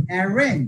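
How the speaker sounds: Arrange, climbing to range then dropping; second-syllable stress. Shown as a demonstration. Teacher confirming the rule, second syllable of arrange stressed. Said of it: "second সিলেবলে pressure যাবে".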